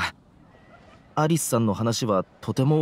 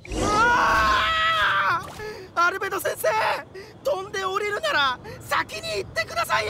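shouting; slower than usual. Acted. Falling behind a flying teacher.

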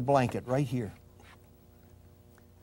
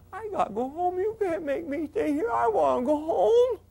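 put-on high, tearful little girl begging; little girl's voice; 13 semitones higher than usual. Joni begging the ambulance attendant, high crying little girl's voice. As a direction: beg like a frightened child. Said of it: "in that little girl's voice".

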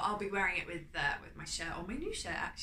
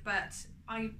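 ordinary delivery

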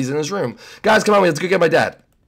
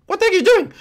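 high-pitched, incredulous yelp; alarmed disbelief; faster than usual. Acted. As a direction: shoot up high in alarm at the stunt. Watching a friend's reckless stunt.

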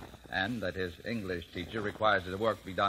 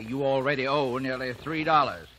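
ordinary talk

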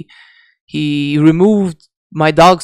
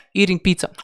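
normal talking voice

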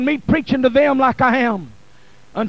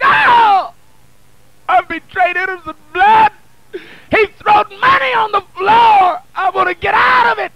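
loud, higher crying plea, rushed at the end; Judas wailing; 7 semitones higher, 6 dB louder than usual. Acted. Preacher acting Judas pleading, a loud wailing cry. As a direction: wail it out like a man undone. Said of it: "He wept. He cried. He begged".

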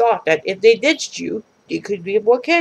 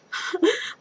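giggle; 9 semitones higher, 6 dB quieter than usual. Acted. Character laughs mid-quote.

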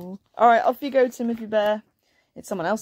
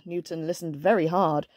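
ordinary delivery

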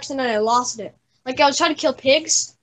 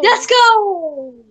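triumphant cheer; 5 semitones higher, 5 dB louder than usual. Real, not acted. Winning the race.

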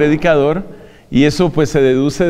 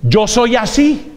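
slow, higher, mock-defiant "that's just how I am"; stubborn self-excuse; 4 semitones higher, slower than usual. Preacher excusing his own quirks, mock defiant. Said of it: "el predicador es".

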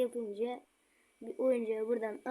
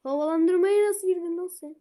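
high, loud alarmed yell; panicked shout; 7 semitones higher, 10 dB louder than usual. Real, not acted. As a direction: scream it in shock. Monster appears from vent.

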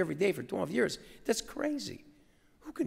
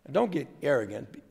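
ordinary delivery

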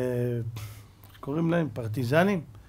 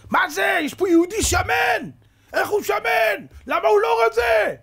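much higher and louder, a raging scream; enraged officer yelling, slow and high; furious officer's shout; 6 dB louder than usual. Retold or imitated.

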